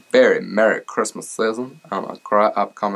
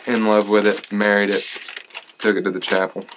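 ordinary talk, nothing marked

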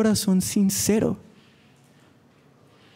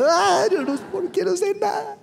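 mock crying; 11 semitones higher than usual. Preacher imitating his friend crying over studies, high hammed-up mock sob. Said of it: "Así empieza a llorar".

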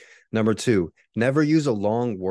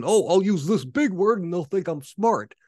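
high, loud, mocking pretentious writer; mock show-off; 10 semitones higher, 6 dB louder than usual. Mocking amateur writers who use big words, high and loud. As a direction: send up a would-be smart writer. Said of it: "If you're thinking".

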